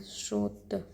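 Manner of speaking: स्रोत said low and soft, the sr cluster clear; स्रोत with a clear sr at the start; careful spelling demo. Shown as a demonstration. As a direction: drop the voice and model स्रोत. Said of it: "स्रोत को ऐसे लिखते हैं".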